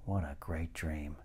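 ordinary talk

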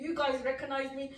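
ordinary delivery

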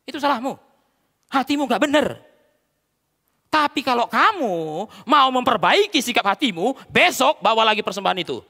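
forceful rebuke. Acted. God telling Cain to fix his heart, forceful rebuke. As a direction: admonish at full force, pitch lifted.

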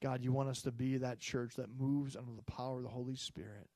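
usual speaking voice

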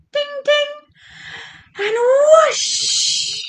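high dings, then a long whoosh; playful broom takeoff noises; magic sound effects. Acted. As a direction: ring it out, then rush away. Imitates magic tap and takeoff.

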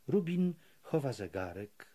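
ordinary delivery, nothing marked

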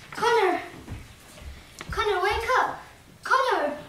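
high urgent calling. Acted. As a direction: call him to wake up. Calling unconscious friend.

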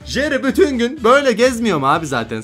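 ordinary talk

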